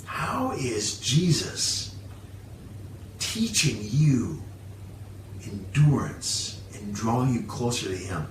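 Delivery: quiet personal challenge to the congregation; soft reflective questions. Real, not acted. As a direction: lean in and ask gently. Preacher asks reflective closing questions.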